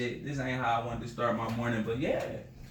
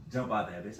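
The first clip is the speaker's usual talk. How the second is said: ordinary talk, nothing marked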